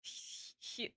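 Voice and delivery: a whispered breathy scarecrow rasp of Hugh; creepy scarecrow. Acted. Streamer voicing the scarecrow while reading the game, whispered. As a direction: breathe the name out like a ghostly scarecrow. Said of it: "I don't know how to make the scarecrow creepy".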